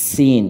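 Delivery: সিন with a long, hissing whistled s; whistling S. Shown as a demonstration. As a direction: draw out the s of সিন like a snake. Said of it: "শীষ বের হতে হবে সাপের মতো".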